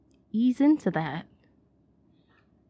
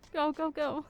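urgent laughing; 6 semitones higher than usual. Acted. Fleeing after mocking homophobes.

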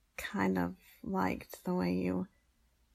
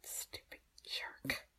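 muttered whisper; under breath; 12 dB quieter, slower than usual. Acted.

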